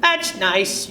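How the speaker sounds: high, offhand grandma; reply lightly as a busy granny; grandmother voice. Acted. Grandmother replying absent-mindedly.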